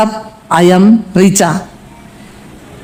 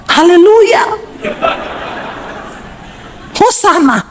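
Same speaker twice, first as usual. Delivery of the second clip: mock tongues; 11 semitones higher, 4 dB quieter than usual. Imagining quarrel in tongues.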